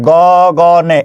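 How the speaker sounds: গগনে drawn out as go-go-ne; syllable by syllable. Shown as a demonstration. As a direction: split গগনে into three slow syllables. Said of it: "কয়টা অক্ষর আছে দেখেন".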